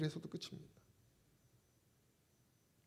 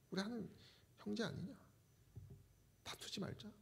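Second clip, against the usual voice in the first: soft, higher-pitched voice of a peacemaker; gentle plea; 5 semitones higher, 14 dB quieter than usual. Retold or imitated. Abraham asks Lot not to quarrel, gentle plea. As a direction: plead quietly, dropping almost to a hush.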